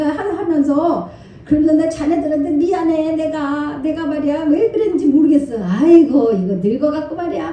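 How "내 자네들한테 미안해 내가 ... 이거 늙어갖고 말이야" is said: lightly voiced jovial old scholar, laughing; a hint of a booming, good-natured elder; hearty old professor. Pastor imitating the professor confessing his mistake, lightly hearty. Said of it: "막 이러시면서".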